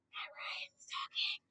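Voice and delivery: slow, very soft whisper; hushed whisper. Real, not acted. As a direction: whisper it slowly, as if not to talk over him. Hushing while the actor talks.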